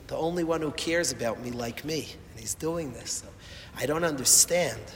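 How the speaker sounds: normal talking voice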